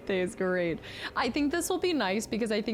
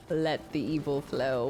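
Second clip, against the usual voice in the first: lower, slow villain voice; mock evil voice; 6 semitones lower, slower than usual. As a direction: play the hammy villain. Joking about going dark side.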